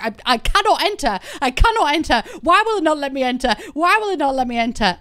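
high, loud flustered panic; loud mock-frantic pleading; flustered friend. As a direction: imitate a friend who can't get in, rising panic. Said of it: "Brittany was so flustered".

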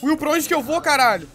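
panicked high shout; frantic yell for directions; being chased. Real, not acted. Fleeing, asking friend where to go.

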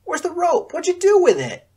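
very high, loud mocking taunt. A narrator's reading. Josiah tugging Scarlet's hair, loud jeering. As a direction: jeer at her, enjoying it.

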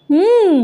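a quick high hum that drops off; mm, agreeing to everything she lists; humming assent. A narrator's reading. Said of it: "ఊ కొడుతున్నాడు".

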